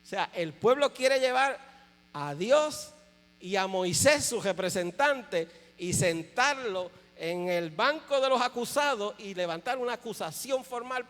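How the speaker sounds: loud, slow, building sermon delivery; forceful preaching. Acted. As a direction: drive it home from the pulpit.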